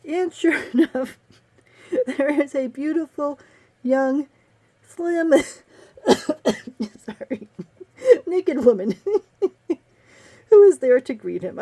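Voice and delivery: real giggles breaking up the words; halting, trying not to crack up; breaking up laughing. Real, not acted. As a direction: struggle to get the line out through laughter. Cannot keep from laughing at the story.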